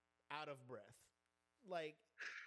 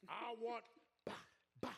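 mock order call; 2 semitones higher than usual. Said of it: "mocking council member".

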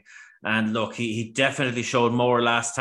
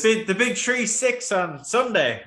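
slow, high, booming announcement; mock grand announcer; 10 semitones higher, 3 dB louder than usual. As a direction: announce it like a big fanfare.

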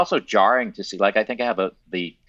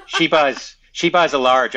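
laughing tease; 6 semitones higher, 7 dB louder than usual. Retold or imitated. Teasing friend's coffee order.

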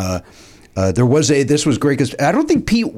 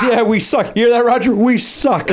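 higher, lightly mocked sarcastic retort; 7 semitones higher than usual. Rock star relaying a heckle to his bandmate, imitated sarcasm. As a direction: throw the insult back, pitched up. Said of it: "he goes".

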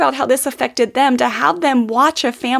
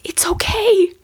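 put-on little boy forgiving; 7 semitones higher than usual. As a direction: imitate a little son comforting mom. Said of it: "He looked at me and he goes".

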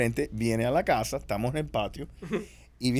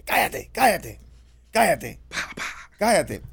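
higher, sharp repeated shushing commands; acted silencing; 5 semitones higher, 4 dB louder, slower than usual. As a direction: cut him off as an impatient grandma. Acting grandma silencing him mid-chase. (abuela mandando a callar, tono alto)

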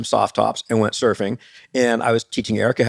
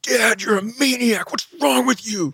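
put-on high, outraged child voice; mock pissed-off kid; 12 semitones higher than usual. Embarrassed son scolding his dad, high mock outrage. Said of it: "Jack was so pissed".

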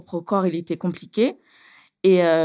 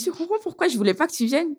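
higher, quick told-you-so jab; mocking brain voice; 9 semitones higher, faster than usual. Her brain scolding her: high, fast mocking told-you-so. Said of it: "Il me dit".

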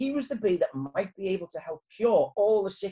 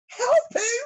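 high, loud character yelp; raised storybook bee voice; startled shout; 7 dB louder than usual. A narrator's reading. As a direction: shout the question in alarm. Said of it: "shouted Arthur".

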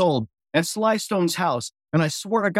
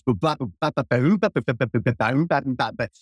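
vocal slap bass riff, ba ba ba; scatting slap bass; 4 semitones lower than usual. Shown as a demonstration. Showing the host his slap style, scatting the riff. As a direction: mouth the bass line in quick ba syllables. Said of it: "I was slap style".

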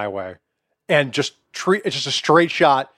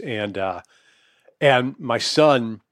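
normal talking voice

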